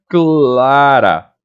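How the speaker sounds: Clara said deliberately, cla-ra; slow model word. Shown as a demonstration.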